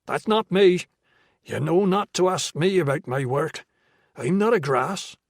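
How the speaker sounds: Irish dealer refusing to inform; defensive Irish brogue. A narrator's reading. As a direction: bristle in thick Irish. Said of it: "in a thick brogue".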